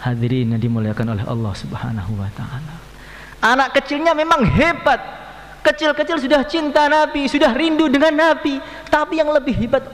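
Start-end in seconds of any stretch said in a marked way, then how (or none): 3.4-9.9 high, loud preaching burst; full preaching. Acted. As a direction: preach it out to the crowd, raised and ringing. Preacher exclaims to congregation. (ceramah berapi-api, suara tinggi dan keras)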